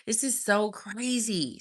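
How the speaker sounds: high-pitched astonished reaction, then stretched out; giddy disbelief, voice way up; amused disbelief. Retold or imitated. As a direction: retell it with a squeaked, incredulous what. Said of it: "I laughed. I was like".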